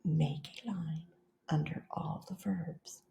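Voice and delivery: whispered, soft, just breath. Shown as a demonstration. Teacher whispers the class's turn, very softly. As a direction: whisper it back like the class would.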